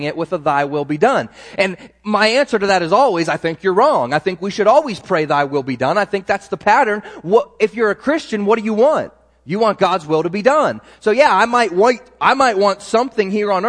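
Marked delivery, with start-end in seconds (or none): none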